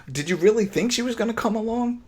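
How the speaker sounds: high-pitched incredulous question. Real, not acted. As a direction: ask it in disbelief, pitch way up. Disbelief at the show's plot.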